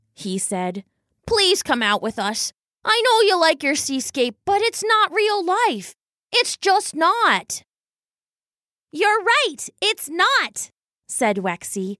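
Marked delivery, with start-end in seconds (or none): 1.3-7.6 put-on higher, earnest young weasel voice; pleading little brother. A narrator's reading. Brother urging his sister to come out, pleading. 8.9-10.7 high-pitched, contrary little weasel; defiant agreement. A narrator's reading. Weasel sister arguing with her brother, defiant.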